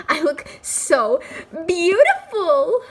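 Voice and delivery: high, slow, drawn-out beautiful; mock vain. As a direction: preen over the word. Mock admiring herself.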